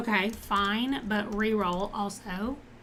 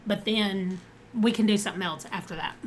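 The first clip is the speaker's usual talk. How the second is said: ordinary talk, nothing marked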